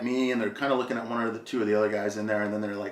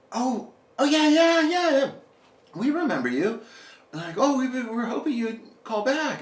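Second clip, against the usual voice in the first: much higher, gushing yeahs; eager photographer; 14 semitones higher than usual. Retold or imitated. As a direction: gush like a fawning fashion assistant. Photographers greet the caller eagerly.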